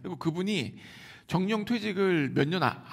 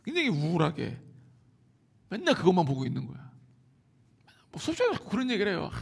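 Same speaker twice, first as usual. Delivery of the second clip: ordinary talk, nothing marked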